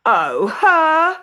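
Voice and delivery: high, loud sneering whine; scornful. A narrator's reading. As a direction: dismiss her with contempt. Stepsister scorning Cinderella.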